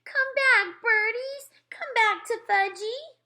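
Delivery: put-on very high, loud little-kid cry; screaming toddler. A narrator's reading. Fudge screaming after the fleeing birds, high loud toddler scream. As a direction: scream after them like a small child. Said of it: "was screaming".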